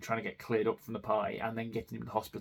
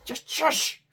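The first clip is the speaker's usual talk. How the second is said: a high, strained mock hush at her son; desperate shush, hammed up; mother mock-shushing her son in the shop, high and strained; frantic shushing; 5 dB louder, much slower than usual. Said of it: "And it's like".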